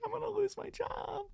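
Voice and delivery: put-on high, whiny girl voice; high mock-tearful coworker; mock crying girl. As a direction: squeak it out like a scared coworker.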